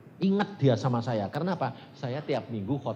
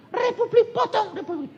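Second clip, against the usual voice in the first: mob war cry; 7 dB louder than usual. Retold or imitated. Retelling how an Irian mob threatened him, high loud war cry. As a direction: imitate a mob shouting its threat.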